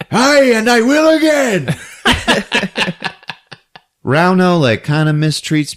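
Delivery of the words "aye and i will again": high, loud boast breaking into laughter; laughing boast. Retold or imitated. Villager joking about filling the freezer, laughing high boast. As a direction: brag it through a laugh.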